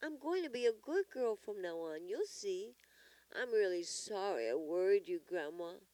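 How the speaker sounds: put-on high, earnest child's promise; sincere little-girl apology; assuring child. A narrator's reading. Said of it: "assured Lolly".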